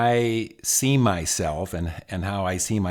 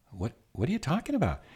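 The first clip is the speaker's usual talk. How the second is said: higher, soft, quick stammered question; reenacted baffled comeback, pitched up; taken aback; 6 semitones higher, 7 dB quieter, faster than usual. Husband answering his wife's criticism, taken aback. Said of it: "And I went".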